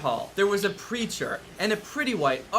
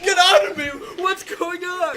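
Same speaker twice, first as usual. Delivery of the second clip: high, loud mock yell; panicked shout; 11 semitones higher, 4 dB louder than usual. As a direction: yell at a ghost inside you. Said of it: "Logan's like".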